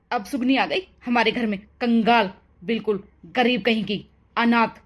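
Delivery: mother-in-law mocking the orphaned bride; sneering mother-in-law. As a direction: spit out the insults with contempt.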